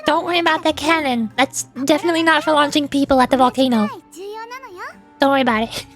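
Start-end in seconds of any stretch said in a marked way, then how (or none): none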